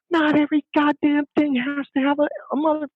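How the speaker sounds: high-pitched mock furious yell; retold scream. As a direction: act out a husband blowing his lid. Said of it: "he screams out".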